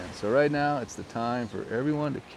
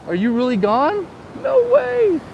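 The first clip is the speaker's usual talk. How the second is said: a much higher, louder falsetto; squeaky mock disbelief; 8 dB louder, slower than usual. Saying goodbye to viewer Siri, high falsetto squeal.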